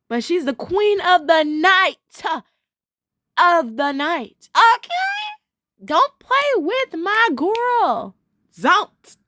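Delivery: high, slow theatrical sass; sassy diva hype. Pretend. Fan playfully defending Dima as queen of the night, sassy diva hype. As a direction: draw out each phrase, swooping up high.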